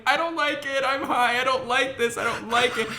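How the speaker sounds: high whiny crying voice; imitating a high teary whine; mock whimpering. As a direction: whimper it like a scared kid. Retold sobbing high.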